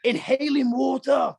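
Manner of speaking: high, drawn-out exclamation; exclaimed disbelief. Real, not acted. Swimmer telling host about a rough swim, exclaimed disbelief. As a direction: stretch it out, pitched well up. Mock horror at inhaling water.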